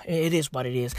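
whispered, resigned; a defeated sigh of a whisper. Real, not acted. Comic shrugging off the country in a whisper.

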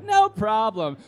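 high, loud breezy confidence; mock bravado. Drunk friends facing razor wire, hammed-up bravado. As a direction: wave away the danger theatrically.

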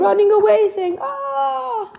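high, hammed-up frightened cry; mock scream. Fleeing animals squealing at the bear, high mock fright.

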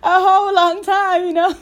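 words breaking up with high laughter; laughing. Real, not acted. Podcaster laughing about the long break, giggling through words.

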